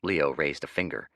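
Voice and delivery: ordinary delivery, nothing marked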